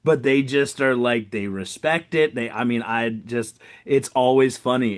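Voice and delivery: ordinary talk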